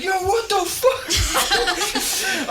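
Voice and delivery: very high, loud, drawn-out disbelief; slow, stretched-out yell of outrage; mock outraged yell. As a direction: imitate a prank victim's shocked shout. Acts friend seeing the pictures.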